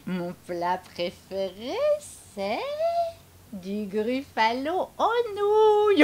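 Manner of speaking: slow gloating. Acted.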